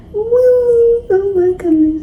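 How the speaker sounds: cheer, then gush; thrilled. Real, not acted. Reader swept up by a romantic scene, thrilled whoop.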